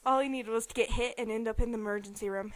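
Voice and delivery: usual speaking voice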